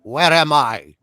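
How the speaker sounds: loud British demand, as the prisoner; forceful TV-drama British accent. Acted. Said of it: "more of an actual British accent".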